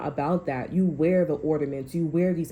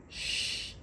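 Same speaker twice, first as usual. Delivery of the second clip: soft voiceless shh; hushing shh; 10 dB quieter than usual. Pretend. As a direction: hush the listener gently. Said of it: "be quiet and be still".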